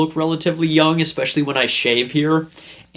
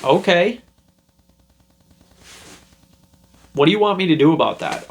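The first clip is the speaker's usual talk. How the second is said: super nonchalant; 4 semitones higher, faster than usual. Retold or imitated. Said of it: "responded super nonchalant".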